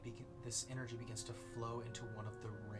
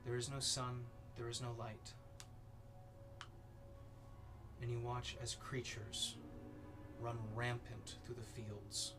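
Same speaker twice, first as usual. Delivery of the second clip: ominous narration; 3 dB quieter than usual. A narrator's reading. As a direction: paint the vision with dread. GM dark scene narration.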